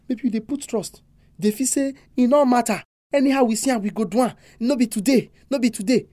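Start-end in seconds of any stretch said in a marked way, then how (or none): none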